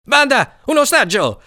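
high, thrilled call to the gang; excited shout. A narrator's reading. Cicin announces a hostage to the gang, excited high shout. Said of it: "si eccitò Cicin".

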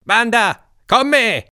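put-on high, loud boyish rallying cry; rally the gang like a small captain; kid leader's call. Cicin calling his gang to dive, high and loud.

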